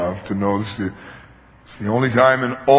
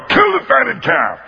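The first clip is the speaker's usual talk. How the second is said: higher, slow, festive command; joyful father's order; 11 semitones higher, 3 dB louder than usual. Acted. Father orders the feast for his son, a joyful high command. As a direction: call out the feast gladly. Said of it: "The father".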